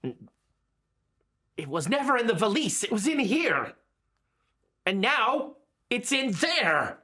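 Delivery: deliberate, drawn-out character voice; Brugia in character. Acted. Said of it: "Brugia says".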